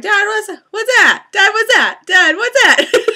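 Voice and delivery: high loud repeated calls to dad, half laughing; insistent pestering. Retold or imitated. Daughter pestering her dad about a star, high and insistent. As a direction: keep tugging at him, voice up high. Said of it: "I was like".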